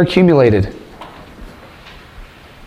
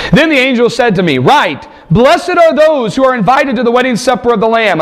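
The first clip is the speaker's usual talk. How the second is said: raised, ringing reading of the vision; scripture reading; 10 semitones higher than usual. Acted. Preacher reads Revelation aloud to the congregation, ringing and raised. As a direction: proclaim the text to the congregation.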